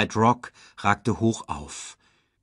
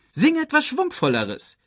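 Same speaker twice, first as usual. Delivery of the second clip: high-pitched, imperious order; commanding. A narrator's reading. Scar orders caged Sasu to sing livelier, commanding. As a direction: order it like a tyrant. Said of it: "befahl Scar".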